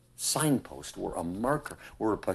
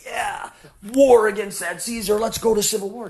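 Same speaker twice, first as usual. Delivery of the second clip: high, loud imitation of a rabble-rouser; rally the mob in mock zeal; hammed-up battle call, pitched way up; mock war cry; 12 semitones higher, 8 dB louder than usual. Mock rallying cry Jesus didn't make.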